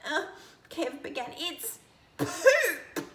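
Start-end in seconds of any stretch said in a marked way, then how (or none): 1.4-3.1 high shriek of disgust. A narrator's reading. Lizzie shrieks at the baby bird, shrieking. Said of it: "shrieked Lizzie".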